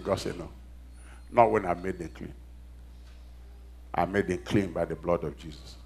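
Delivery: low, soft voice of God answering; hushed preaching. Acted. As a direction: drop right down and state it quietly. Drops voice for God's answer.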